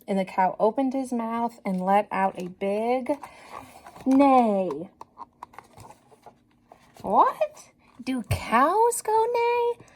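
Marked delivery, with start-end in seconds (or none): 4.0-4.9 a horsey neigh; whinny the word like a horse. A narrator's reading. Cow lets out a neigh. 7.0-9.8 high, slow, puzzled what; high drawn-out teacher surprise for kids; mock surprise. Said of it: "this book is so silly".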